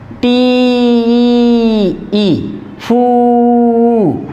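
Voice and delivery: "टी ई" and "फू" each held very long; drawn-out syllables. Shown as a demonstration. Teacher showing three syllables, "टी ई, फू" drawn out. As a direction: stretch "टी" and "फू" on steady notes. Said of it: "देखो यहाँ पे तीन syllable".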